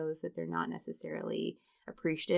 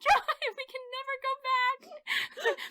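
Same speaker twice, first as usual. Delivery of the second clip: high, wailing plea to drive off; a hammed-up cry to escape; mock panic; 7 dB louder, slower than usual. Wife begging husband to drive away, mock wailing.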